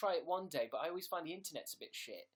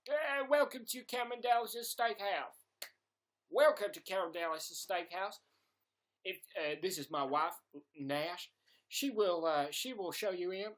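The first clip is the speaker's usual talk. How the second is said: put-on Texas accent; 3 semitones higher, 4 dB louder than usual. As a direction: play a Texan steakhouse host with a broad accent. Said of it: "I can always do Texas".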